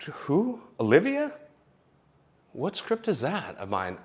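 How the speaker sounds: high, puzzled questions tailing off slow; mock confusion at the printer; confused. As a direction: puzzle over a strange script. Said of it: "I'm like".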